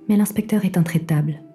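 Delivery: ordinary talk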